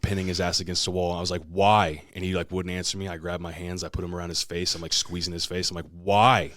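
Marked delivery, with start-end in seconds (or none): 1.5-2.0 loud, insistent question; acted angry demand, pitch held down; retold demand. Retold pinning brother to wall. 5.9-6.5 loud, forceful outburst; acted exasperated shout, pitch held down; retold demand. Retold climax of demanding an answer.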